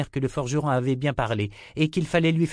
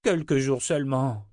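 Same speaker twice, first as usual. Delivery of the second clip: higher, pleading insistence; insistent; 4 semitones higher than usual. A narrator's reading. Townsman begs a short delay of his lord, insisting. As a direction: press him for a small favour. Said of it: "insista l'homme".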